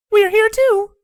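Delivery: very high, loud chirp from mom; high-pitched call to be noticed; speaking up. A narrator's reading. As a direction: pipe up loudly in a high voice. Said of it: "Windy spoke up".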